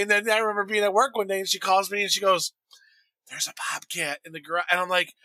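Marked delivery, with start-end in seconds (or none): 3.3-4.7 hushed imitation of a nervous whisper. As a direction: report it softly, as if it might hear. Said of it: "Why are you whispering?".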